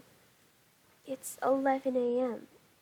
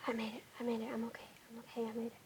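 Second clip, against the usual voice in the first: relieved breathy whisper; 7 dB quieter, much faster than usual. Real, not acted. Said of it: "Did I get quieter as the video progressed".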